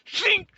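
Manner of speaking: sharp high sword-slash noise; sword shink sound. Retold or imitated. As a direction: squeak the blade swipe high and quick.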